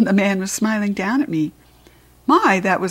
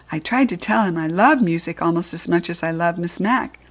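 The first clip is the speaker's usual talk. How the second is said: ordinary delivery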